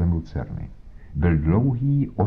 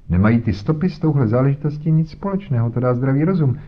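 higher, self-satisfied bluster; pompous inspector; 5 semitones higher than usual. Acted. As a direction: lecture Holmes with condescension. Voiced inspector Jones talking. (nafoukaný inspektor, výš posazený hlas)

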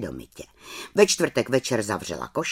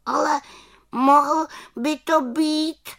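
high, slow hesitant musing; uncertain little witch; 3 dB louder, slower than usual. Acted. Little witch doubtfully answers the raven, high voice. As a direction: ponder it unsure in a high voice. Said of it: "rozpačitě uvažovala malá čarodějnice".

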